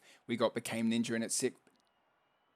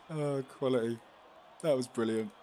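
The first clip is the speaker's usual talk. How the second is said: ordinary talk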